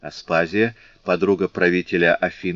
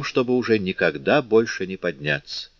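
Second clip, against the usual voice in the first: ordinary delivery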